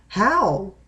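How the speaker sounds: mildly shocked, gasped question. A narrator's reading. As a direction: narrate it as a small gasp of surprise. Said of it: "Lettuce gasped".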